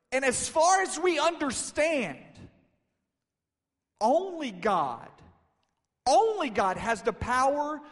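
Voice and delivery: high, shouted, stretched-out disbelief; astounded disciples. Retold or imitated. Disciples seeing a dead girl walk, loudly astounded. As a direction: preach their shock at full voice. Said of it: "disciples had to say".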